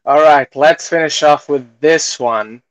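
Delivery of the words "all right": usual speaking voice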